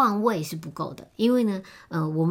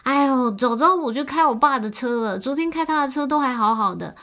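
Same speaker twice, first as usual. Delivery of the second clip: higher, louder, fretful whine; whiny girlfriend; 7 semitones higher, 6 dB louder than usual. Retold or imitated. Girlfriend whining to boyfriend about her broken car, high and loud. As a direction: pout and complain that the car broke. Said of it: "然后女生又说".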